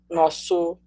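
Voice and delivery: ordinary talk, nothing marked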